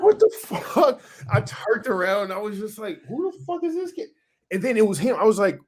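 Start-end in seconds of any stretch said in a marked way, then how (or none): 0.0-1.0 high-pitched, incredulous outburst; half-laughing, high-pitched disbelief; retold shock. Retelling shock at being hit. 3.1-4.1 very high, softer incredulous squeak; high, hushed mock outrage; retold disbelief. Retelling bewilderment at his attacker.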